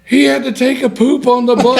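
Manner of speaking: very high, loud comic squeal; hammed-up high-pitched report, near laughter; gleeful disclosure. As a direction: deliver the punchline in a squeaky voice. Acted answer, punchline of funny story.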